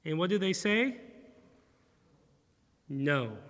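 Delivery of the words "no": low, flat grumbled no; sullen no. Retold or imitated. Disciples answering Jesus with a sullen no. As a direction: mutter it like a sulking husband. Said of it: "Could you imagine that tone".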